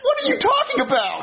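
very high, squealed protest; exasperated disbelief. Acted. Man accused of being a spy, exasperated disbelief. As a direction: burst out in disbelief, voice shooting up.

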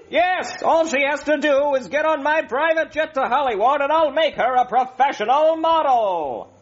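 high, smooth-talking showbiz agent; oily Hollywood agent pitch; schmoozy hollywood agent. Acted.

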